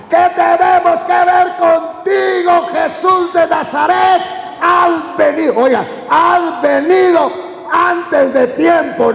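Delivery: high, drawn-out preacher's shout as the possessed man; possessed man crying out at Jesus; demon's shouting. Acted. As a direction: cry out slowly at full pitch, each word stretched. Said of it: "empezó a gritar".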